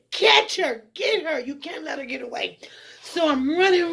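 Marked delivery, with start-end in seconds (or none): 0.1-1.4 retold pursuers yelling to catch her; pursuers' shout. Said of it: "I hear them saying". 1.4-2.6 unvoiced urgent whisper. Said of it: "I hear them saying".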